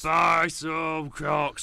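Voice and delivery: slow, drawn-out shouted ad; shouting. Pretend. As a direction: bellow it like a market seller. Shouted mock advert repeated.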